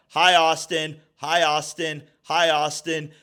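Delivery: high, loud repeated greeting; insistent mocking call, same tune each time. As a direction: imitate a neighbor hollering through a door. Neighbor calling past cop through door.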